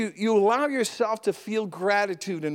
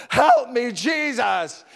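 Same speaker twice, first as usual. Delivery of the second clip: loud, higher retold cry for help; calling out; 3 semitones higher, 4 dB louder, slower than usual. Preacher imitates a speaker whose day falls apart, calling out. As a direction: imitate someone calling out in desperation. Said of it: "she just called out".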